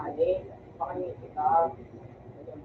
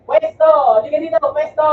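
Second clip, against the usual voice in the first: loud, raised-pitch command; shouted order; 5 semitones higher, 9 dB louder than usual. Real, not acted. Player ordering a teammate into position, shouted command. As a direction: call it out urgently to a teammate.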